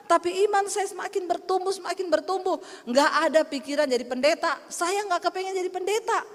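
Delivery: ordinary delivery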